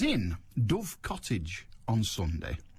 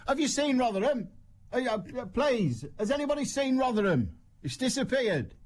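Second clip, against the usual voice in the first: high-pitched, frantic plea for help; mock panic; 11 semitones higher than usual. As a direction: plead in pretend alarm, voice pitched right up. Acting someone searching for lost Rotherham.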